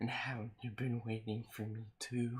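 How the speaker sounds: creepy guy voice. Acted. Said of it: "read this in a creepy guy voice".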